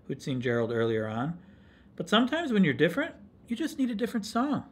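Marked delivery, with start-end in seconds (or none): 1.9-4.6 high, quick little cricket voice; encouraging cricket. Acted. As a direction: pipe advice brightly as a small cricket. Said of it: "coughed a cricket".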